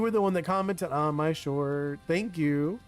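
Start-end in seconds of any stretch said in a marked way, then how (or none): none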